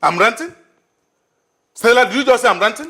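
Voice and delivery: indignant retort. Acted. Man outraged at being called ranting, loud disbelief. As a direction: throw her words back at her. Heated quarrel with Stella.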